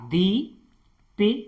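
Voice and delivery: दीपिका drawn out slowly; slow model word. Shown as a demonstration. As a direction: stretch दीपिका syllable by syllable. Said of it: "जैसे दीपिका". (दीपिका को धीरे-धीरे बोलना)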